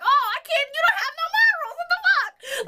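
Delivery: high, fast outraged voice; outraged quote. Retold or imitated. Telling him off about his morals, in a high outraged voice. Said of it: "So I was like".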